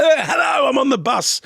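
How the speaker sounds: mock phone shout. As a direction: bellow it like a prankster on a giant phone. Shouting into giant phone prank.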